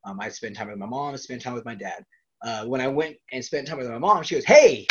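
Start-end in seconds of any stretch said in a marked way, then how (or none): 4.5-4.9 sudden call for attention; mom calling out. Retold or imitated. Mom hailing her kids, loud and high. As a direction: imitate mom's loud, high "hey".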